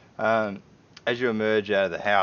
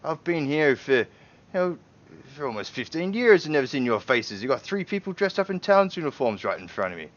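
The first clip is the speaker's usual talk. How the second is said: higher, louder doubtful townsman; suspicious homeowner; 6 semitones higher, 6 dB louder than usual. Acted. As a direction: push back on strangers he doesn't trust.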